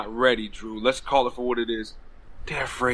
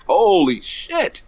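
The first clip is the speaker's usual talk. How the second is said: drawn-out, higher, louder awe; amazed reaction; 5 semitones higher, 3 dB louder, much slower than usual. Retold or imitated. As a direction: stretch it out, stunned at the crowd's roar. Reenacting reaction to crowd's cheers.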